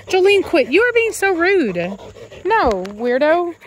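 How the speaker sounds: high-pitched fond telling-off; scolding a pet. Real, not acted. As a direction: chide your dog for bad manners. Tells off her dog.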